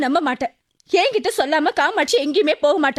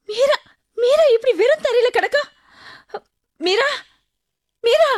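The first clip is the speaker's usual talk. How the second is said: high-pitched panicked calling; frightened, urgent, voice pitched way up; alarmed; 6 semitones higher than usual. Acted. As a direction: cry out in shock, trying to rouse her. Neighbour finds Meera collapsed on floor.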